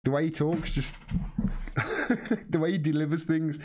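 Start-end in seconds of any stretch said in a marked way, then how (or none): 1.0-2.4 breathy laugh. Real, not acted. Laughing at annoying presenter.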